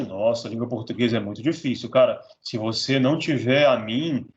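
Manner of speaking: low-pitched parody of a scaremongering salesman; put-on low voice warning of hardship; mock course seller. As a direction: drop the pitch and sell the difficulty. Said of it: "te apresento a dificuldade".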